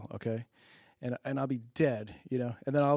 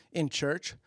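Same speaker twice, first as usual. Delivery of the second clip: ordinary delivery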